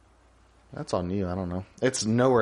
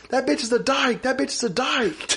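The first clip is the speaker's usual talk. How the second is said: Peyton Manning; 12 semitones higher than usual. Retold or imitated. Quarterback's supposed uncensored call, imitated holler. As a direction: chant the insult like a snap call. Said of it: "But really, he's like".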